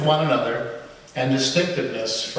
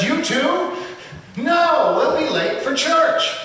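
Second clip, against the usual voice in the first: much higher, louder donkey protest; hammed-up donkey protesting louder; 12 semitones higher, slower than usual. A narrator's reading. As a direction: bray the protest out high. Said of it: "even louder, protests".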